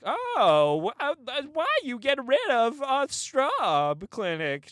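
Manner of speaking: high, loud, drawn-out whine of protest; mock outraged complaint. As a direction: imitate an outraged local complaining. Mocks complaining locals.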